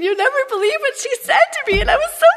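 high, fast, whining sob-story voice; mock yourself crying to your husband over gossip; hammed-up wailing complainer who ends in a yelp; whiny gossip mock. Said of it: "Go home to Jason".

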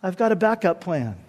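soft, unhurried, laid-back calm; cool reassurance. Acted. Preacher as Boaz calming the gasping crowd, coolly reassuring. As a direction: settle them down quietly, with pauses. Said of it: "Boaz would look at us and just say".

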